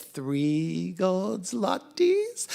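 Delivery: very slow, rising temptation; teasing drawn-out question; coaxing offer. A narrator's reading. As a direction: dangle the prize word by word. Dog tempts cats with more money.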